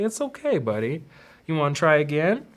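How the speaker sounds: put-on cradle and reassure a child; soothing parent. Parent figure cuddling child.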